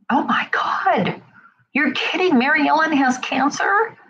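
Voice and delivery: mock stunned gossip reaction; shocked disbelief. Acting out reaction to bad news.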